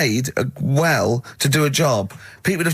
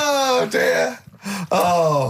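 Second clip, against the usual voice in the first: mock crying; 6 semitones higher, much slower than usual. Real, not acted. Host still mock-crying.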